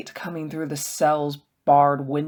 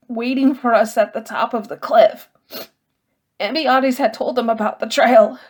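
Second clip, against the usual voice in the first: distressed Sophos; 7 semitones higher, 4 dB louder than usual. Acted. Sophos telling of deaths.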